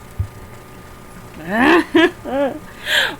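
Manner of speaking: long high laugh at self; long self-mocking laugh; high disbelieving laugh. Real, not acted. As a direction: laugh at your own blunder. Laughing at own mistake.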